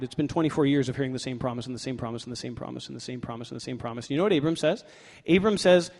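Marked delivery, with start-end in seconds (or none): 0.0-4.1 fast, low, droning run; weary repetition. Acted. Preacher lists Abram's years of waiting, wearily repetitive. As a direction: rattle it off, trailing softer and softer.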